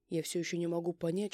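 ordinary delivery

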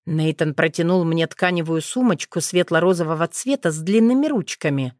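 usual speaking voice

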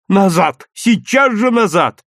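loud, high, stretched demand; forceful boyish exclamation; urgent demand. A narrator's reading. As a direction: insist on it, pitch climbing. Boy demands cat back.

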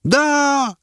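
very high shout of yes; women shriek agreement; crowd shout. A narrator's reading. Women in the crowd shout yes at the herald.

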